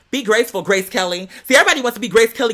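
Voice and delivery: normal talking voice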